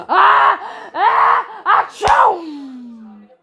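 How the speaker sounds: loud, high building gasps into a sneeze; acted sneeze. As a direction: wind up and sneeze big.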